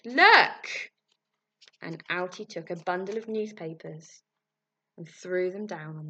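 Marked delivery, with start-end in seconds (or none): 0.0-0.9 high, loud shout. Acted. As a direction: shout it to grab the room. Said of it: "shouted Mister Prink".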